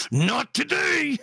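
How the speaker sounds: high, slow, hammed-up battle cry; dog pumping itself up, high and drawn out; mock defiant dog cry; psyched-up dog yelling. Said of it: "He's like".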